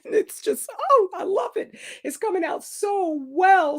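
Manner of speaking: high, giggly delight; real happy laughter through the words; laughing with joy. Real, not acted. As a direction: bubble over with laughter while gushing. Delighted about her writing.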